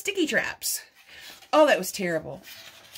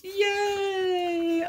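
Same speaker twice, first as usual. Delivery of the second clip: bright little cheer of delight; happy cheer; 5 semitones higher than usual. Real, not acted. Opening a gift book.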